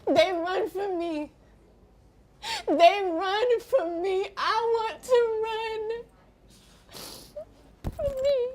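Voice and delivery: slow, high, crying wail; tearful anguish. Acted. Woman with HIV on people running from her, crying.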